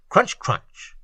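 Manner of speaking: usual speaking voice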